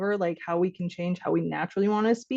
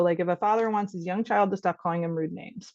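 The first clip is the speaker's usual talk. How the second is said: ordinary talk, nothing marked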